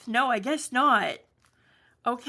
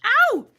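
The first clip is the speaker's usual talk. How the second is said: high loud yelp of pain; sudden sharp ow; 13 semitones higher, 11 dB louder than usual. Real, not acted. Paper rips suddenly.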